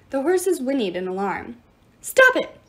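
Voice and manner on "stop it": high, loud exasperated yell. A narrator's reading. Nyx yelling at her squabbling children, loud yell. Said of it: "Nyx yelled".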